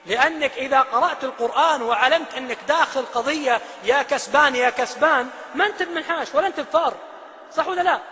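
normal talking voice

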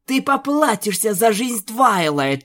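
high, loud hissed threat; vicious hiss. Acted. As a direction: spit the threat through bared fangs. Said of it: "прошипела Луна, злобно оголяя клыки". (злобно прошипев)

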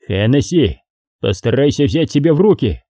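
plain reading voice

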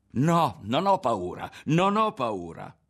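slow, high, loud cry; defiant yell. A narrator's reading. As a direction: yell each denial with force. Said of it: "urlò di nuovo Ario".

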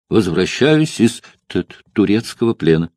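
repeated stammer on the first sound; stutter. Acted. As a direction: catch twice on the opening consonant. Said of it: "И заикание".

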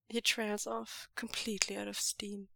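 plain reading voice

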